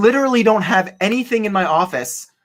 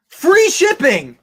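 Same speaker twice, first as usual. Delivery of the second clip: exasperated; 11 semitones higher, 4 dB louder, much slower than usual. Real, not acted. Exasperated about unsold item.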